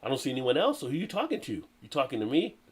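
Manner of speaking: ordinary delivery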